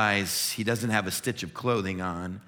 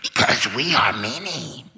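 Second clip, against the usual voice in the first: slow, louder, otherworldly voice of many; demon voice; 7 dB louder, slower than usual. Acted. Demon answering the stranger's question, slow menacing voice. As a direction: let it rise out of him like something else speaking. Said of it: "it comes out".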